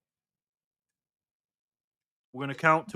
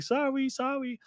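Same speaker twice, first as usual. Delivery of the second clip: put-on high, soft little-kid voice; imitating small child; 12 semitones higher, 5 dB quieter, slower than usual. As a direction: mimic a toddler's small apology. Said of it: "He's like".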